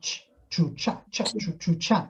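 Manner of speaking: chi chu cha with a crisp ch as in chair; drilling the Spanish ch sound. Shown as a demonstration. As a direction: run through the ch syllables clearly. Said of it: "esse som do chi".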